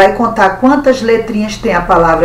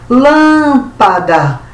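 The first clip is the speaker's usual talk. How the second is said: "Lâmpada" with a long, higher first syllable LÂM; stress on LÂM; 5 semitones higher, 3 dB louder, much slower than usual. Shown as a demonstration. Teacher showing pupils the strong syllable, LÂM stressed in "Lâmpada". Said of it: "sílaba forte".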